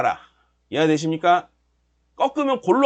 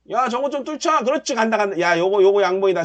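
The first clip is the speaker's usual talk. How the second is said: ordinary talk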